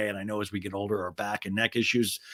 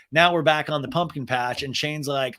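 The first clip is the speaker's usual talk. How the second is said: usual speaking voice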